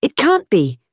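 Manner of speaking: high-pitched outburst of disbelief; sudden high exclamation; startled cry. A narrator's reading. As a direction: cry out as if leaping up. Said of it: "he cried, leaping".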